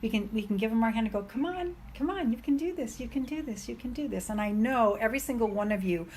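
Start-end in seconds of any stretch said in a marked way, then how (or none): 1.2-4.3 higher, softer coaxing; soft cheering on; gentle encouragement. Pretend. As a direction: coax a friend to try. Encouraging a friend, hand out.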